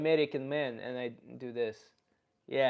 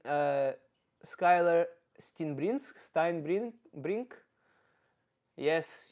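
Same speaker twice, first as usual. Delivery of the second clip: put-on comic Russian character accent; Russian-accented comedy persona. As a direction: speak English with a thick Russian accent. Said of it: "I'm gonna stop doing the accent".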